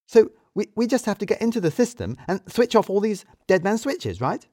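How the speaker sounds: high, loud hurried plan; eager Edie. Acted. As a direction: rush the idea out hopefully. Edie voiced by narrator.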